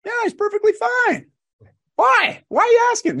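squeaky-high hammed-up old-timer; old man voice. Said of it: "says, like".

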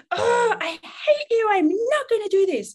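put-on screaming child, high and loud: no, I hate you; hysterical child. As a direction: wail it like a furious toddler. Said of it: "child storms off".

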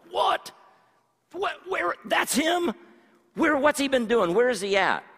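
high, loud indignant questions; sudden outraged disbelief; imagined angry outburst. Retold or imitated. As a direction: voice Phil's shocked anger at the name.

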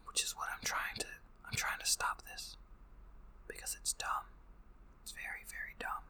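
whispered aside. Real, not acted. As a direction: let it slip out as a breathy aside. Whispered aside about the dumbness.

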